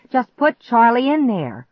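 high, loud impatient order; exasperated shout; hollering. A narrator's reading. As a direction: holler the command. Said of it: "I hollered".

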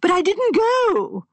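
high, slow, pleading insistence; high and excited. A narrator's reading. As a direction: rise up, excited and desperate. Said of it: "Sanford's voice rose high and excited".

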